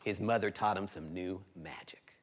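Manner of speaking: hushed storyteller whisper. A narrator's reading. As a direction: breathe it like a secret.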